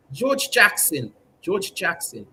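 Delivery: ordinary talk, nothing marked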